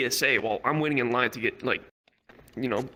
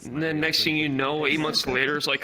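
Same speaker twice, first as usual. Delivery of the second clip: normal talking voice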